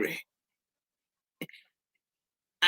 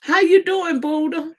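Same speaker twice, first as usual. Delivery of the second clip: sweet pet voice; 10 semitones higher than usual. Real, not acted. Greeting Buddha in a high, sing-song pet voice.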